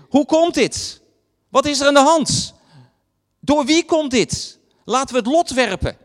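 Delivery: alarmed sailors yelling to each other; panicked sailors shouting. Retold or imitated. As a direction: shout it out across a roaring storm.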